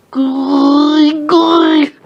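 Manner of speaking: loud, high drawn-out squeals; mock freezing squeal. Friend with ice going down his shirt, mock squeal. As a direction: squeal as if ice hits your skin.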